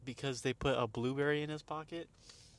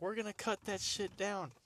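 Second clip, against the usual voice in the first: higher-pitched mock insistence; retold insistent threat; 8 semitones higher than usual. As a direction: imitate a worker laying down the law. Retold threat from tree cutters.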